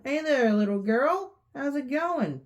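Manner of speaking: high, sing-song luring voice; creepy fake-friendly greeting; sly friendly stranger. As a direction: sweet-talk the little girl. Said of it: "she hears a voice".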